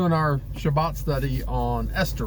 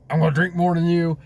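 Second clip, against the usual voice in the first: loud, higher, quick drinker's challenge; drunken boasting; 5 semitones higher, 5 dB louder, faster than usual. Acted. One of the men at the feast out-boasting another, drunken challenge. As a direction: blurt it out as a competitive drinker. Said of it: "It's probably a drunken rage".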